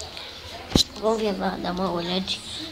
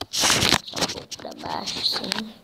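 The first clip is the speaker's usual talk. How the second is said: whisper; 3 dB quieter, slower than usual. Real, not acted. Whispered aside to helper.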